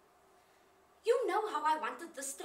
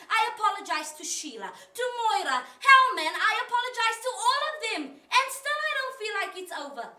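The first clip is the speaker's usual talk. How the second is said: loud, high-pitched frustration; exasperated outburst; 7 semitones higher, 9 dB louder than usual. Acted. Woman telling God she already apologized to everyone, exasperated. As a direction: argue your case, voice raised.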